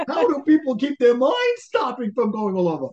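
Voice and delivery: high, loud exasperated cry. Retold or imitated. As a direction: throw up your hands. Exasperated mock inner voice.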